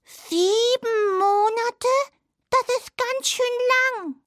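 slow, high and a bit downcast mouse; a little sad. Acted. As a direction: let the long wait sink in, gently sad. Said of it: "sah ein wenig betrübt aus". (etwas betrübt, langsam und hoch)

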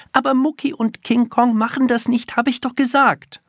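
put-on high child's voice, pushing her point; insistent little girl. A narrator's reading. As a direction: argue stubbornly as a small girl. Little girl's held dialogue voice.

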